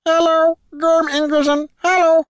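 very high, loud goblin squeal; excited goblin. A narrator's reading. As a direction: shout back with vigorous enthusiasm. Goblin shouts back enthusiastically.

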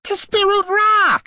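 very high, slow screech; exasperated creature. A narrator's reading. Gleeback warning the oblivious heroes, exasperated screech. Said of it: "threw up his arms in exasperation".

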